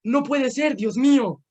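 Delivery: high, loud mock cry of "¡No puede ser, Dios mío!"; retold cry of mom. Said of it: "escucho un grito de mi mamá".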